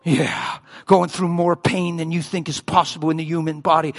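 ordinary delivery

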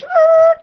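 high, loud buzzer-like "Er"; buzzer noise. Retold or imitated. God buzzing the preacher's plan, a high loud buzzer noise. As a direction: make a wrong-answer buzzer sound. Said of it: "And God goes".

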